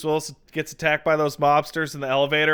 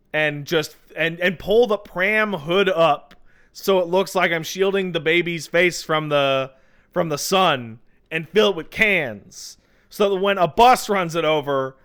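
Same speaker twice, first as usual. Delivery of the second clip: ordinary talk, nothing marked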